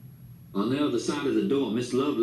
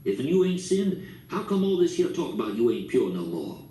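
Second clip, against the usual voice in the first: plain reading voice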